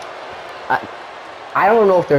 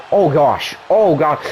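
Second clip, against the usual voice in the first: ordinary talk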